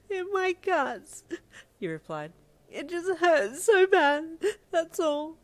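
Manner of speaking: high, loud, slow sobbing; crying. Acted. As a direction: sob the words out. Crying daughter in pain.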